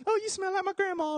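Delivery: high, sweet and happy; relieved and cozy; delighted little kid. Retold or imitated. Boy comforted by old lady, high sweet delight. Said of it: "I'm like".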